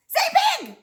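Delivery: high, loud burst of enthusiasm; excited prompt. Shown as a demonstration. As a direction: call it out high and loud to the kids. Cheering children to repeat a word.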